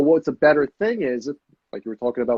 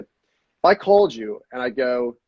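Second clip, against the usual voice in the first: normal talking voice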